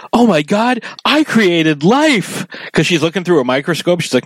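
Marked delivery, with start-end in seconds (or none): 0.1-2.5 much higher, slow, astonished; imitating Lisa, amazed. Fan quotes Lisa seeing life under the microscope, much higher and slow. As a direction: gasp it out as Lisa in wonder. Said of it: "when she's like".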